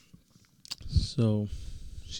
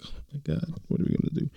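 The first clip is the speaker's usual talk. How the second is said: higher, panicky mock fretting; 6 semitones higher, faster than usual. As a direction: fret like you're caught out. Panicking about being late.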